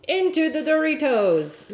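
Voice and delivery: high, gleeful flourish; playful announcement. Pretend. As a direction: announce it like a fun little reveal. Gleefully dunks cheese into crumbs.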